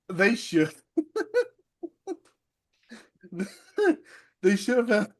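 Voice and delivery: real, helpless laughter breaking up the words; laughing hard. Real, not acted. Host cracking up at his own wrestling idea, laughing hard. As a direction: struggle to talk through hard laughter. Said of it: "I'm laughing so hard".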